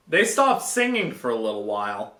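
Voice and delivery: ordinary talk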